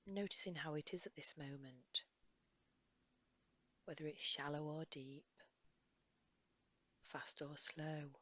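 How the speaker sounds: soft, unhurried guidance with long pauses; guided meditation. Acted. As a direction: gently lead a listener through a body scan. Leads a body scan meditation.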